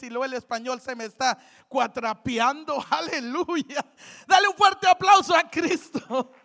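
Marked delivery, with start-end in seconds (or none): 4.3-6.3 loud call for applause; rallying shout. Real, not acted. Preacher urging the congregation to applaud Christ, rallying shout.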